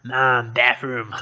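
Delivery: slow, drawn-out cartoon kid voice; South Park impression. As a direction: imitate a cartoon kid calling Mom. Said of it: "From South Park".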